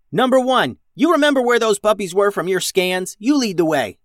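loud, high captain's order; commanding order. A narrator's reading. Captain orders robot to lead the way, loud command. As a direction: bark it out like a captain in a firefight. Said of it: "ordered Captain Max".

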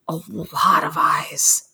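hushed, slow whisper; nature documentary whisper. Acted. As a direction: whisper it like a wildlife narrator. Repeats phrase, whispered documentary aside.

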